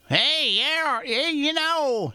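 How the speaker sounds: very high, drawn-out TV cowboy sidekick voice; Festus impression. Imitating Festus from Gunsmoke, high slow twang. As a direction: stretch it out high like the Gunsmoke character. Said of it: "he doesn't even talk like that".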